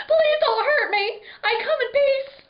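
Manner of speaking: high, loud panicked yelp; frightened yelp. Acted. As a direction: squeal it out, begging for mercy. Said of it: "I yelped".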